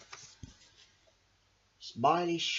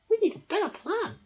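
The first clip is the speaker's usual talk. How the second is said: high starfish voice; 5 dB louder than usual. Acted. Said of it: "Starfish suggested".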